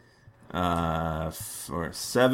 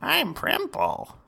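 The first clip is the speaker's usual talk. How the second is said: squeaky high Primple voice; high character voice. Acted. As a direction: announce yourself in a very high squeak. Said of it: "Primple, you're up".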